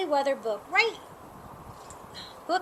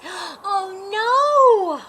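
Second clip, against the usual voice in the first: sudden rising cry of dismay; startled oh no; shocked cry; 5 semitones higher, 9 dB louder than usual. Acted. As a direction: gasp it out in alarm, then louder.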